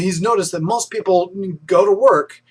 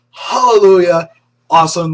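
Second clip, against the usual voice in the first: excited; 2 semitones higher, 9 dB louder than usual. Real, not acted. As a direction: shout it out in triumph. Cheering good news on the show.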